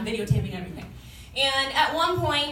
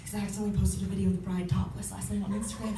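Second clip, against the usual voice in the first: quiet confession; 4 semitones lower, 7 dB quieter, faster than usual. Retold or imitated. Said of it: "I'm like".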